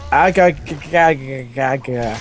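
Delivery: hammed-up pained cry. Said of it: "cried out Garfield".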